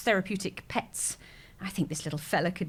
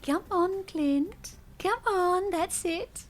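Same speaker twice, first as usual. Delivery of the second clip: high, sing-song coaxing of a little animal; high encouraging pet-talk; 9 semitones higher, much slower than usual. Acted. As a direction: call him on like a shy pet. Coaxing her tortoise along.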